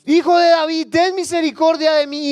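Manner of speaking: high, full-voiced cry for mercy. Preacher as blind man defying the crowd, shouting. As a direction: refuse to be silenced, shout it out. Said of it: "más duro gritaba".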